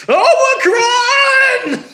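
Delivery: huge high shout, stretched slow; dramatic shout. Acted. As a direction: bellow it like a mock announcement. Mock dramatic outburst, sick voice.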